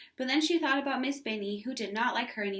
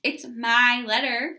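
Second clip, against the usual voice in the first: possessive insistence; 8 dB louder, slower than usual. A narrator's reading. Ramona refuses mother's help.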